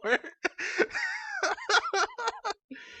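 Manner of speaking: high burst of laughter; loud shrieking laugh. Real, not acted.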